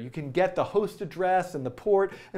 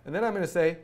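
usual speaking voice

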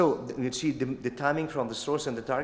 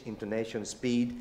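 normal talking voice

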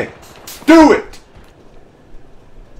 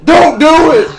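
higher, louder shout; 8 semitones higher, 7 dB louder, slower than usual. Real, not acted. Yelling in a friend's ear, shout. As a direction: shout the warning. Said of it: "yelling at me".

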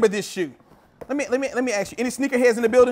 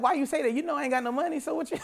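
half-laughing, soft protest; 9 dB quieter, faster than usual. Retold or imitated. Laughing it off to his wife, soft and amused. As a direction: chuckle through the words. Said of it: "And I'm like".

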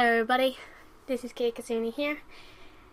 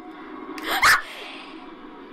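real high scream of fright; sudden startled shriek; 3 dB louder than usual. Real, not acted. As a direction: scream at the jump scare. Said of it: "I screamed".

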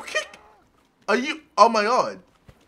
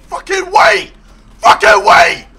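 shouting; 8 semitones higher, 9 dB louder than usual. Real, not acted. Panic as game chaos starts.

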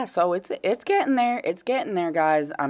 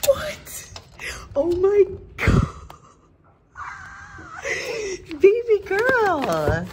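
excited squeal; much slower than usual. Real, not acted. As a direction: squeal with joy. Opening packages.

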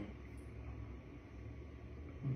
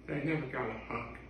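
much softer, low aside; quiet deadpan; 5 semitones lower, 15 dB quieter, faster than usual. Real, not acted. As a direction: drop it under your breath. Deadpan aside after the laugh.

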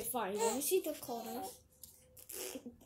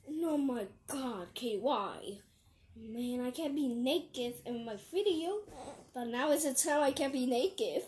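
ordinary talk, nothing marked

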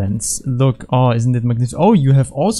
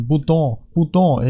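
French "bouton", stress on last syllable; French accent; 3 semitones higher, slower than usual. Pretend. Player repeating button in French, mock-French accent.

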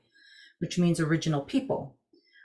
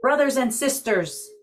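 raised voice calling to everyone; higher, calling to the gathered animals; 7 semitones higher, 4 dB louder than usual. Acted.

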